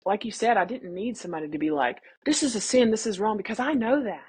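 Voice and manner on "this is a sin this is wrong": higher-pitched imitation of a judgmental scold; mock scolding. Imagined critic lecturing a pregnant woman, mock scolding. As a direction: mimic someone pointing out a sin, pitched up. Mimicking someone who would scold her.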